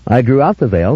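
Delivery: ordinary delivery, nothing marked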